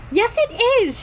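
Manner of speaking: high, quick contradiction; snapping. A narrator's reading. Girl contradicting him mid-argument, snapping back. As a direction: snap back with pitch jumping up. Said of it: "she snaps".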